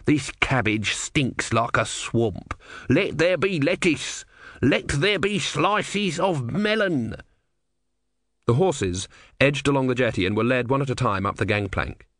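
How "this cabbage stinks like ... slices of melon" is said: slow complaint rising into high, grand commands; drawn-out godly decree from a cranky tortoise; grumpy tortoise god. Acted. Tortoise god demands better food, grumpy then slow and lofty.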